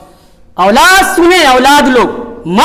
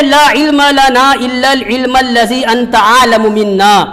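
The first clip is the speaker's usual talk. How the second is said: Arabic recitation; 3 semitones higher, slower than usual. Acted. Preacher quoting Ibn Abbas's tafsir, slow recitation. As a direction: intone the commentary slowly.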